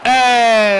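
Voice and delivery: loud, high celebratory shout; long elated "hey"; goal cheer. Real, not acted. Celebrates a goal just scored.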